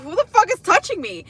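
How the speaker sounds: high, loud startled yell; startled shout. Retold or imitated. Startled at a stranger's touch, loud high yell. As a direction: shriek at the stranger who grabbed her arm.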